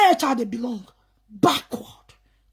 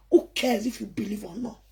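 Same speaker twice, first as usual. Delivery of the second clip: whisper; 5 semitones lower, 6 dB quieter than usual. Real, not acted. Whispered aside.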